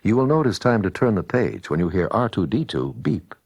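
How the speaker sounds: ordinary delivery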